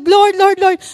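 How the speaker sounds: put-on loud echo of the child's call; loud retold plea to God. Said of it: "narinig ko si A A".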